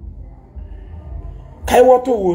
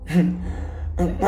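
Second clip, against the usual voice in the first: short laugh; 2 semitones lower, 8 dB quieter than usual. Real, not acted. Laughs at own rant.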